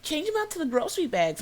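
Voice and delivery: high-pitched mimicked customer order; mock a pushy customer, pitched well up; demanding Karen. Customer telling the cashier to switch bags, demanding. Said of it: "She's like".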